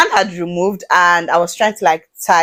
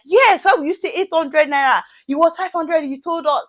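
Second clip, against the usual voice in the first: retold shouting; 9 semitones higher than usual. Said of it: "they were even shouting more".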